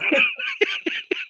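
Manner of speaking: a high squealing laugh. Real, not acted. Laughing at the name, a high squeal. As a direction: let out a shrill giggle.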